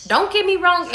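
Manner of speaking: emphatic. Real, not acted. Sudden emphatic aside to Amber.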